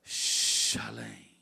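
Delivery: whispered low word. Acted. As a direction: breathe the word Shalem low and voiceless. Mysterious hushed key word.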